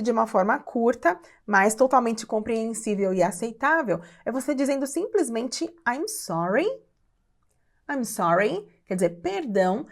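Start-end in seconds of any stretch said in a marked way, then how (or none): 5.9-8.7 "I'm sorry" rising at the end like a question; question intonation. Shown as a demonstration. Teacher models asking someone to repeat, "I'm sorry" with questioning rise. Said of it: "com essa entonação de pergunta".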